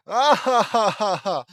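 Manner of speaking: loud high maniacal cackle; insane laughter. Acted. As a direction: laugh like a madman. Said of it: "Insane laughter".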